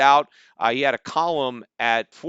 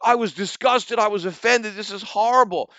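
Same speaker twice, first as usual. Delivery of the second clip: high, loud, mocking shocked whine; sarcastic imitation of fake disgust; mock outrage; 7 semitones higher, 4 dB louder than usual. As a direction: parody a pearl-clutching critic. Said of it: "and he said".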